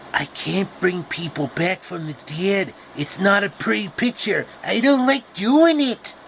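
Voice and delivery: high, nasal-free creepy whine of Peter Lorre; Peter Lorre impression. Apple tree talking like Peter Lorre, high and creepy. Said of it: "pretty much identical to Peter Lorre".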